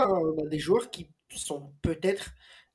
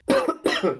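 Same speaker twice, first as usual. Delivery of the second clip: loud real cough; a hard dry cough; coughing fit; 3 semitones higher, 5 dB louder than usual. Real, not acted. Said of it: "Pardon, je tousse".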